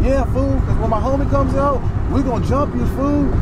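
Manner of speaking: high-pitched taunting mimicry; mock threat. Acted threat from drunk man.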